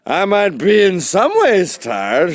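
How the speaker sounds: loud, high, slow theatrical delivery; hammed-up orator, drawn out and loud; Orson Welles. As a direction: declaim it like a grand old actor. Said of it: "fucking Orson Welles".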